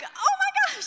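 high, loud excited squeal; gushing delight. Retold or imitated. Woman opening the door to her fiance, gushing delight. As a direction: squeal with hammed-up surprise.

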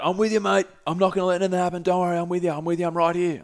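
high, strained mock yell at normal volume; held-back impression of shouting to a friend. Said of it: "Roisey's yelling out".

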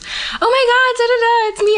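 high squealing mock gush of an old classmate; gushing fake surprise. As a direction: overdo the fake delight, way up high. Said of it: "she acted like I didn't know".

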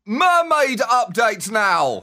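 slow, loud headline call; announcer shout. Acted. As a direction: boom it like a headline. Mock headline shouted slowly.

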